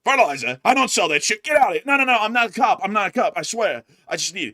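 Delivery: loud, high panicked denial. Retold or imitated. Host voicing shady dealer loudly refusing the farmer. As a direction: yell back nervously. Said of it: "then he's like".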